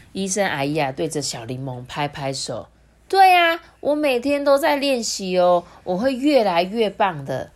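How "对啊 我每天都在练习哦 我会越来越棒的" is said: put-on high, loud eager child voice; proud child. A narrator's reading. Little Lemon tells auntie about daily practice, proud. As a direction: chirp back confidently.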